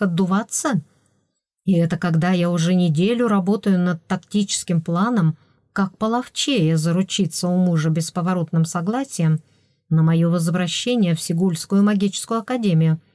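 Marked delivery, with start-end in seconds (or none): none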